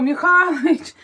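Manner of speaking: mother's own loud call of "Михалич". Retold or imitated. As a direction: call the husband by name, out loud. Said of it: "Кричу".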